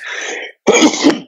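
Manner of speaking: real sneeze; sudden sneeze mid-sentence; sneezing. Real, not acted. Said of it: "Sorry, I'm gonna sneeze".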